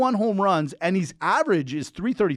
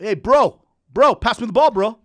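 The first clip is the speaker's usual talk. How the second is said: louder mock on-court call; calling for the ball; 4 semitones higher, 6 dB louder than usual. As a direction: call out to a teammate, playing along. Calls for ball as Bronny.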